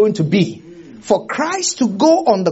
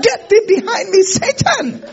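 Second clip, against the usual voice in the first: forceful rebuke; 12 semitones higher than usual. Retold or imitated. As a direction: cast him off with a sharp order. Jesus repeats rebuke, louder.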